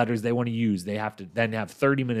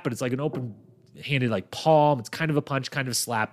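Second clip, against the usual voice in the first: normal talking voice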